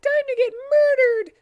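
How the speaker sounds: very high, loud squeaky villain line; shrill villain squeal. Acted. Charmy threatening Knuckles on stage, very high loud squeal. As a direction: squeal it like a cartoon killer. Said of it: "said Charmy as he started".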